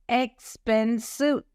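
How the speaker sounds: Expensive slowly, each syllable ex-pen-sive set apart; syllables shown slowly. Shown as a demonstration. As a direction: stretch Expensive out to count its three syllables. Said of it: "three syllables".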